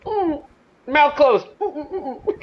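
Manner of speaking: high, tight-lipped hum; acting out sealed-lip alarm; mock muffled panic. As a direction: hum high as if keeping your mouth shut. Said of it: "I'd be like".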